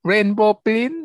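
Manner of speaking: high-pitched title announcement, last word drawn out; show-opening announcement. Real, not acted. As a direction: sing out the episode title to open the show.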